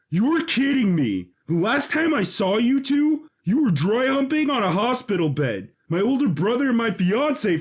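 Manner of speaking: betrayed man ranting at his brother; exasperated rant. Acted. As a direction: let the outrage spill over. Jesse rants at brother and fiancée.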